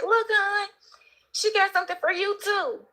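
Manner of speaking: excited wife. Retold or imitated. Woman presenting a gift to her husband.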